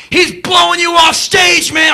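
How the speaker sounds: very high, loud jeering yell; taunting shout. Retold or imitated. Crowd taunting the singer, loud jeer. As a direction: heckle him hard. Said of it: "People are going".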